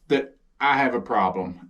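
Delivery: ordinary talk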